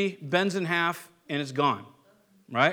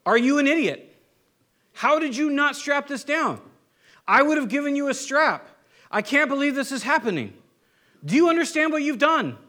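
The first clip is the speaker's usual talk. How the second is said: mock furious rant; 6 semitones higher than usual. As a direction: blow up at the neighbor over the broken mower. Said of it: "be belligerent back".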